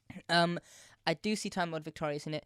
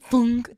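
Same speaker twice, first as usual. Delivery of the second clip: imitated pipe thunk; 5 semitones higher, 10 dB louder than usual. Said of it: "the pipes just go like".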